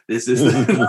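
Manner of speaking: flat mechanical robot voice. Pretend. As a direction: speak like a deadpan machine. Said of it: "I'm talking like a robot".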